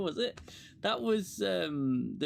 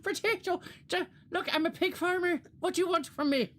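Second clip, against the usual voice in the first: mock Irish accent; 11 semitones higher than usual. Mocking Irish pig farmer colonist.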